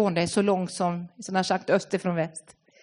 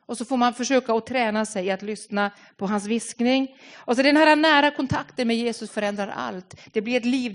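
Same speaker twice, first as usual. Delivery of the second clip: usual speaking voice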